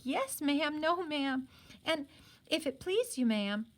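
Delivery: high, meek maid squeaks; squeaky maids. A narrator's reading. Maids answering their mistress, high squeaky yes-ma'ams. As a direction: squeak like a bobbing servant girl. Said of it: "They'd curtsy and squeak".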